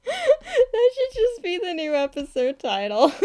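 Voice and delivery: high, squeaky talking through laughter; laughing. Real, not acted. Joking about a new episode title, laughing hard. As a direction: barely get it out for laughing. Cracking up at own joke title.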